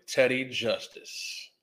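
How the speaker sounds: slow, drawn-out announcer name; lightly voiced old-timey announcer; old-time sheriff show voice. Shown as a demonstration. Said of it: "I don't know why I said that like that".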